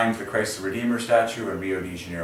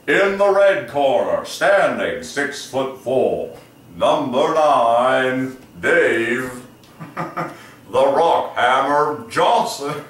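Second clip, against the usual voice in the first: slow booming ring announcer; fighting game announcer; 3 semitones higher, 4 dB louder, much slower than usual. Pretend. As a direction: stretch every word for the crowd. Mock fighter introduction.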